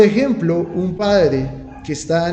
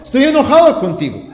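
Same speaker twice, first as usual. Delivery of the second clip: mock angry; 7 semitones higher, 6 dB louder than usual. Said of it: "no podemos decirle a Dios".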